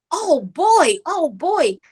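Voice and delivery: high-pitched imitation of amazed children; excited kids. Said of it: "they were so excited".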